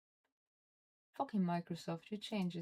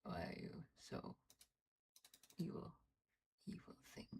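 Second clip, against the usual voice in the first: muttered under breath; 10 dB quieter than usual. Real, not acted. Muttering at broken software.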